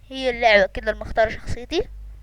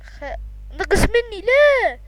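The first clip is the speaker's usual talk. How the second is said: dismayed cry; 9 semitones higher, 4 dB louder, much slower than usual. Real, not acted.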